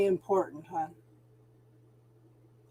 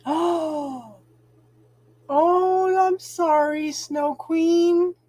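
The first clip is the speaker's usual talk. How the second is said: higher, startled "oh, oh" into sorry; surprised apology; 7 semitones higher, 3 dB louder, much slower than usual. Real, not acted. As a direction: caught off guard by sad news in the chat. Suddenly notices a viewer's comment.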